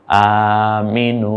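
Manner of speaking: আমিনু with the final নু long and stretched slowly; drawn-out aminu. Shown as a demonstration. Teacher to students, drawing out আমিনু slowly. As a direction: stretch the ending of আমিনু like a teacher reading Arabic. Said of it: "একটু টেনে বলবো".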